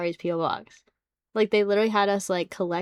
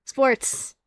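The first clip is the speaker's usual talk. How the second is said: louder exasperated repeat; 5 semitones higher, 6 dB louder than usual. Real, not acted. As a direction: throw the word out in disbelief. Repeats sports out loud.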